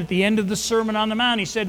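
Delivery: ordinary delivery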